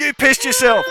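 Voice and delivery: high, loud taunt; jeering shout. Acted. Guard ribbing his mate outside, jeering shout. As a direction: crow it out to shame a mate.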